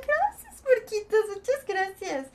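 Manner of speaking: high squealed thanks to a viewer; squeaky thank-you. Real, not acted. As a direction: pitch jumps high on gracias.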